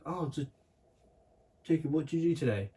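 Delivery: mock mum quizzing her son; a hint of a sing-song mum asking about school; imitating mum. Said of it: "[name] does the best impression of me".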